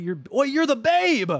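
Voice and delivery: ordinary talk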